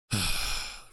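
long exaggerated sigh through the nose and mouth; a theatrical sigh of a tough day; loud sigh. Shown as a demonstration. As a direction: heave a big fed-up sigh at dinner. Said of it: "real loud sigh at the dinner table".

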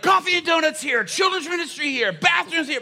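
high, loud rapid shouted directions; frantic announcements. Retold or imitated. Pastor dodging gunman during announcements, high loud frantic shouts. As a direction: rattle them off while dodging. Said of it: "I'm moving really fast".

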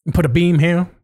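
put-on teacher's Mongolian accent, lightly done; a hint of a Mongolian accent. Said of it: "Mongolian accent or something".

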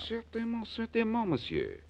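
eager fake French, certainement pitched high; French accent. Blackie fooling the fence, eager fake French accent. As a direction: Blackie posing as a Frenchman, gushing. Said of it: "try out your French accent".

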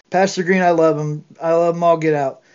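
ordinary delivery

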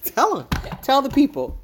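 loud, high-pitched urging; playful prodding. Real, not acted. Mom goading her son to tell listeners, playful prodding. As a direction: egg him on, high and insistent.

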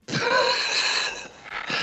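breathy high giggle; squeaky laugh; high airy laugh. Real, not acted. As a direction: laugh off the sign-off. Laughs at sign-off.

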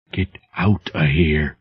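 soft unvoiced whisper of terror; trembling whisper. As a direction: whisper it, shaking with fear. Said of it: "as a trembling whisper".

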